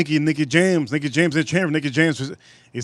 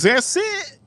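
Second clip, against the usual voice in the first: squeaked up high in disbelief; high mock homeless guy. Retelling the homeless man scoffing at two bucks, high mocking voice. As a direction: mimic the guy's outraged "that's it". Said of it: "He goes".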